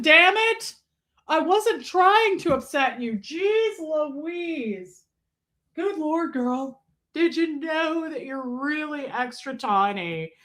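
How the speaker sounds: high, indignant comic character voice; hammed-up high voice, scolding then musing; put-on character voice. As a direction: play a huffy, affectionate little character. Plays a pet-like character.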